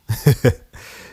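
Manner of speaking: bright burst of laughter. Real, not acted. Host laughing at his own ranting habit. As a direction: laugh off the rant.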